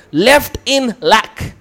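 very slow, each word apart; slow emphasis. Shown as a demonstration. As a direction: draw out every word. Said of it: "slowly defining Greek word".

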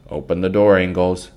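loud call at the door. Acted. Man outside the store calls for Pa, loudly. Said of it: "one called".

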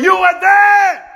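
high, loud, slow proclamation; big preaching shout; shouted call. Shown as a demonstration. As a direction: bellow it for the church to repeat.

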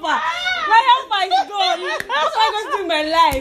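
high, very slow, loud laughing lament; mock wail. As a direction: wail in despair while laughing at it. Wailing that her helper left.

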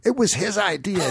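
high, pleading blame-shift; retold protest. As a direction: protest to an angry crowd, hammed-up. Retold pleading with hostile crowd.

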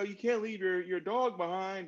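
high, bright pep-talk push, fixing it; mock fix-it reassurance. Friend trying to fix a suicidal person's feelings, mock upbeat. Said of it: "we go into fix it mode".

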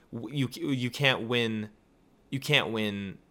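normal talking voice

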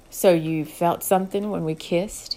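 ordinary delivery

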